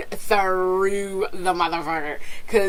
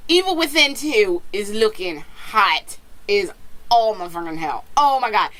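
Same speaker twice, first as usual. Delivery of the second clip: usual speaking voice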